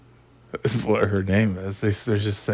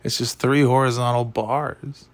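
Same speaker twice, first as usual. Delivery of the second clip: ordinary talk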